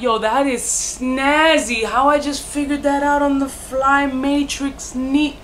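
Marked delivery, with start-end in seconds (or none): none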